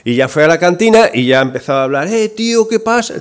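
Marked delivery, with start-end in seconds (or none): 2.1-3.1 casual slangy greeting. Acted. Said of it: "Empezó a hablar en un idioma más coloquial".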